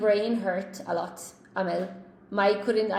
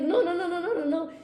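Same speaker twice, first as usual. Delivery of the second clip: insistent no; 7 semitones higher, much faster than usual. Retold or imitated. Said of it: "She was like".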